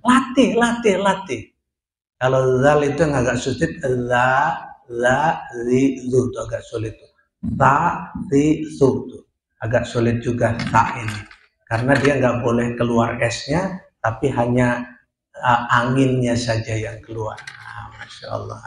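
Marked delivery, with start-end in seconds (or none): 0.0-1.5 urging practice. Real, not acted. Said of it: "urging students to practise". 17.4-18.6 quiet aside. Real, not acted. Aside, much lower and softer.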